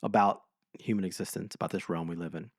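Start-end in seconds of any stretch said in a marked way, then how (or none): none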